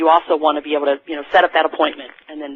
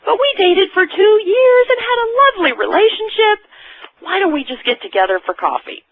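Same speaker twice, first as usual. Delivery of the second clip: hammed-up suitor; 4 dB louder than usual. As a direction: ham up a hopeful pickup line. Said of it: "And you go".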